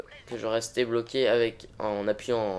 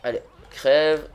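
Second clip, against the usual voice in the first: slow, loud menacing kill line; slow theatrical villain menace; 3 semitones higher, 7 dB louder, slower than usual. Pretend. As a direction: growl it out like a villain. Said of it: "je vais bien me mettre dans l'ambiance de ce jeu".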